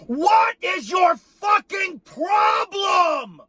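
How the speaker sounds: high furious yell at the fans; exasperated shout; angry shout. Real, not acted. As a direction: scream the question at them. Ranting at complaining fans.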